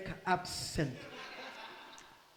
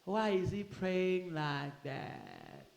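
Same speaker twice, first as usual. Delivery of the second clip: skeptical onlooker; 3 semitones lower, 7 dB quieter, much slower than usual. Retold or imitated. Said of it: "People that are alive".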